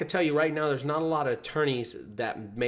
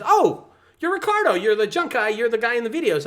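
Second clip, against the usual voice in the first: higher, excited lady's voice; imitating an excited lady; 9 semitones higher, 7 dB louder than usual. Customer recognizing the junk guy, lightly voiced excited lady. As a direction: play the thrilled customer recognizing him. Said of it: "and she says".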